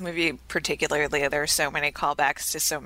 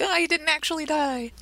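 higher-pitched mock-relieved quote; pitched up in mock surprise; mock realization; 12 semitones higher than usual. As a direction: act out a naive reaction, pitched way up. Quoting a mock reaction.

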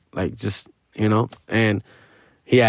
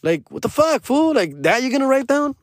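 incredulous inner voice; 9 semitones higher than usual. Retold or imitated. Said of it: "I'm like".

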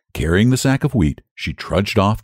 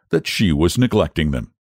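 plain reading voice

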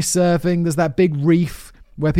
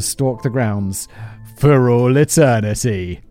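spooky narration; 3 semitones lower, slower than usual. Acted.

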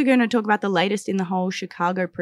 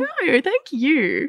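drawn-out higher "No, thank you" in a mock accent; put-on accent; 7 semitones higher, much slower than usual. Host thanks guest back in a silly accent. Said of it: "What accents are we doing now?".

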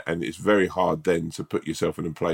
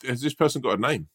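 higher-pitched accusing question; accusatory; 6 semitones higher than usual. Real, not acted. Co-host asking who sent the email, accusatory. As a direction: ask it like an accusation. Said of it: "in as accusatory a way as you did".

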